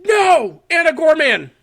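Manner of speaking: high loud playful protest; mock outraged shout. Real, not acted. Host protesting his co-host's score, high playful shout. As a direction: yell it in fake betrayal. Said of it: "mock outrage at co-host".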